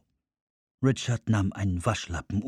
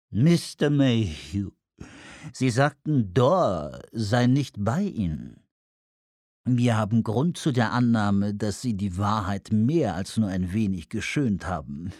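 fuchshafte, ölige Anruferstimme; ölig, fies. Acted. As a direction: sprich ölig und mit gedehnten Worten. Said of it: "Der Tonfall war ölig, fies, fuchshaft". (öliger, fuchshafter Tonfall)

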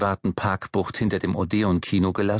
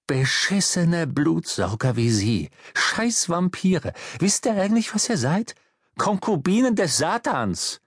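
indignant, escalating tirade; angry cursing; 6 semitones higher than usual. Acted. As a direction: hurl abuse, pitch climbing as you go. Insulting the traffic wardens.

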